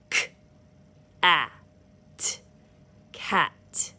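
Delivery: "S, a, p, sap" sound by sound, then blended; one finger per sound. Shown as a demonstration. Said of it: "show you how to break up your words".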